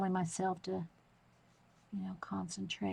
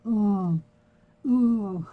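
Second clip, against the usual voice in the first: mock moaning ooh, loud; 12 dB louder than usual. Husband imitating her moaning while she paints, mock ooh. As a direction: groan ooh like straining while drawing. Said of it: "You're sitting there going".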